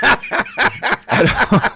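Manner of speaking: high, loud laugh breaking through. Real, not acted. Laughing mid-sentence.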